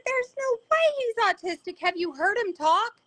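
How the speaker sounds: high, incredulous protest. Retold or imitated. A mother arguing with the doctor, incredulous and high. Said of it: "I said".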